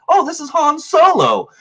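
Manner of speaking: light up as if spotting Han Solo; excited recognition. Retold or imitated. Retelling the movie to a friend, excited high exclamation.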